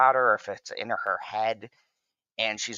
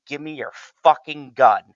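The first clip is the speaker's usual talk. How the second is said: louder, harder demand for the gun; angry repeated demand; 5 semitones higher, 8 dB louder than usual. Retold or imitated. She repeats the demand to the bodyguard, angrier and louder. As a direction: snap it out the second time, fed up. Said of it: "and she's like".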